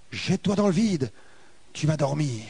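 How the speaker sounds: plus bas et plus doux, tentateur; voix douce qui attire. Retold or imitated. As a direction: souffler l'invitation au vide. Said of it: "une autre voix qui était douce". (voix basse et feutrée de tentation)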